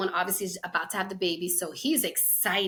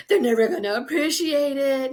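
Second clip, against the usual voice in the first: higher, louder grumble with a laugh; mock complaint; 6 semitones higher, 8 dB louder than usual. Mimicking the men on the other side, mock complaint. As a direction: imitate a griping husband, amused.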